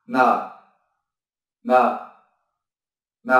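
a hummed "n" held alone, no vowel; just the "n" hum; isolated n sound. Shown as a demonstration. Teacher sounding out "n" alone for learners, held hum. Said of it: "phát âm là".

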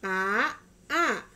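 A and ba each said loud and slow, apart; over enunciating. Shown as a demonstration. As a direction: sound out each syllable, A then ba. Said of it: "ikutin Mama ya".

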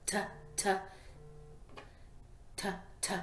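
"T" clicked softly, no voice; t sound drill. Shown as a demonstration. Teacher modelling t with hands on belly. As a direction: tap "t, t" quietly from the belly. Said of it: "feel that t sound in your belly".

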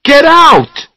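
very high, loud order; shouting. A narrator's reading. Charlie throwing Raymond out of the bedroom, shouting. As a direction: yell him out of the room. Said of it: "he shouted".

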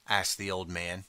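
plain reading voice

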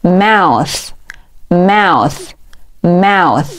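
"mouth" slowly, ending in a tongue-between-teeth th; joined-up pronunciation. Shown as a demonstration. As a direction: draw out "mouth" and finish on voiceless th. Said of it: "连起来". (慢读mouth，词尾咬舌发th)

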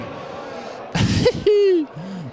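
high, delighted laugh. Real, not acted. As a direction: burst out laughing. Laughing after the miss. (nevetés)

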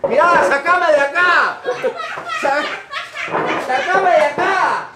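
high-pitched comic yell; desperate mock plea. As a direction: cry out to be rescued, hammed up. Argentino suplica que lo saquen. (grito suplicante, exagerado)

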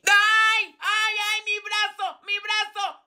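high, hammed-up cries of pain; quejido de dolor. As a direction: wail as if your arm hurts. Se queja de dolor en el brazo. (quejándose de dolor, agudo y exagerado)

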